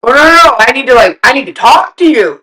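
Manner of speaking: high, loud rush of insistent words; imitating eager husband. Imitating the husband insisting he must talk, eagerly. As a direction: mimic him bursting to explain.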